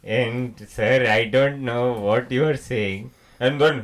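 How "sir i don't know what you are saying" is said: slow, polite Indian accent; restrained Indian-accented politeness; first Indian accent. Shown as a demonstration. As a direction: say it measured and formal in an Indian accent. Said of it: "there are two Indian accents".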